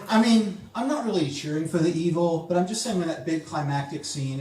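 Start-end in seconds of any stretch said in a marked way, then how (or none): none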